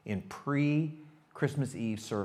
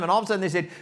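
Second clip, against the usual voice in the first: ordinary talk, nothing marked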